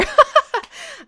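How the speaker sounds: loud, high burst of laughter. Real, not acted. Streamer amused by a cheeky question about Trent, bursting into laughter.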